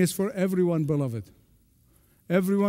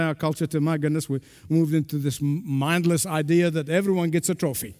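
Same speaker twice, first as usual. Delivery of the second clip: normal talking voice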